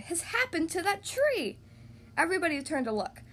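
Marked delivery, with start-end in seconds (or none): 0.0-1.6 high, slow cry of astonishment; astonished cry. A narrator's reading. King spots the changed tree, astonished cry. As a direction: cry it out high, dragging the words. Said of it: "he cried".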